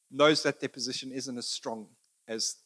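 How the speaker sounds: ordinary delivery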